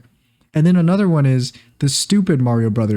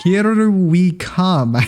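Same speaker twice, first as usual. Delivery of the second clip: mock New York accent; 4 semitones higher, much slower than usual. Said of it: "trying to do a New Yorker accent".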